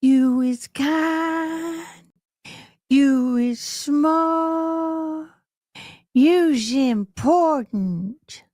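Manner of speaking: slow and tender, as in the movie Help; quoting a film line. Retold or imitated. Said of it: "in the movie Help when the girl goes".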